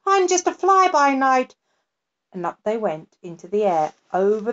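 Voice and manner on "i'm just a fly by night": higher impish creature voice; the creature tells the girl who he is, high and breezy. A narrator's reading. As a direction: toss it off like a carefree night sprite. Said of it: "said the creature".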